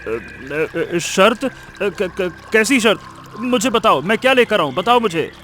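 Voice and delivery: halting, stuttering plea for an answer; nervous stammer. Acted. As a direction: stumble on the first sounds, then beg to know. Greedy man startled by cave's demand. (घबराहट भरी हकलाहट)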